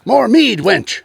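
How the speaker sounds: loud, high boisterous demand for mead; viking feast shout. Pretend. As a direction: bellow for mead like a drunken Norseman. Great Hall, mock feasting lord.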